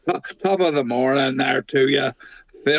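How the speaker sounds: hammed-up stage Irish greeting; jokey Irish accent for the lads; mock Irish accent. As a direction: greet him like a cheery Irishman, a light Irish lilt. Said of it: "They both sound Irish".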